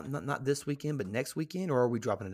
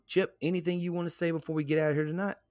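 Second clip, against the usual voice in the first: ordinary talk, nothing marked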